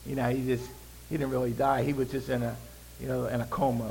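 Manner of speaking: low, soft, offhand skeptic; doubters' voice. Retold or imitated. Preacher mimics people denying Jesus died, low offhand murmur. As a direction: toss it off quietly like a doubter. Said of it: "Some people said".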